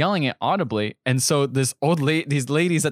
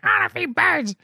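put-on little kid voice. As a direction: play the small boy who only wants to feed birds. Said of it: "And the kid's just like".

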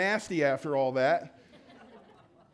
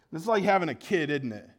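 ordinary delivery

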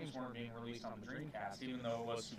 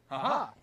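gloating laugh; 7 semitones higher, 10 dB louder than usual. Real, not acted. Gloating after winning the argument.

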